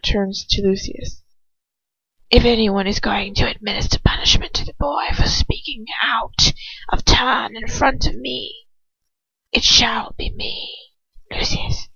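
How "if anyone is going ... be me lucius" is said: lightly reprimanding. Acted. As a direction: chide him in a hushed, menacing voice. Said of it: "voice is lightly reprimanding".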